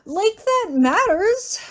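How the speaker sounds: high, slow, scornful scoff; drawn-out bitter sarcasm. Acted. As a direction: sneer it in disbelief.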